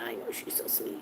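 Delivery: whispering. Real, not acted. Owner whispering how sweet her cat is. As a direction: whisper tenderly about the cat.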